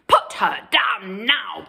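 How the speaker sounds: loud commanding woodcutter; bellow. Acted. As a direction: boom the order. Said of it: "bellowed the woodcutter".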